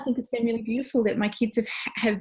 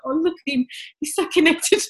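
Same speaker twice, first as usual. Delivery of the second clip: higher, laughing through happy tears; teary laugh; 10 semitones higher, 5 dB louder than usual. Retold or imitated. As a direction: gush over him, half laughing, half crying. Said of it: "I'm in tears".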